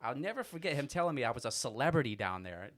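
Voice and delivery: usual speaking voice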